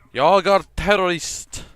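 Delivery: loud, high put-on Swedish shout; Swedish accent. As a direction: bellow it in a hammy Swedish voice. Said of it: "change my game to Swedish".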